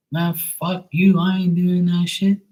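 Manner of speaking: mock irritated snap; annoyed reply. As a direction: brush her off, irritated.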